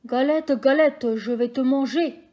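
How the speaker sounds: big, loud, slow growl of the wolf; the grey wolf booming at the galette; loud wolf threat. A narrator's reading. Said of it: "dit le loup". (loup menaçant, voix forte et lente)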